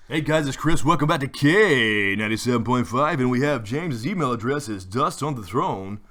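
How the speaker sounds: lightly voiced cheesy radio DJ; a hint of late-night radio host; sexy DJ voice. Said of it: "my sexy D J voice".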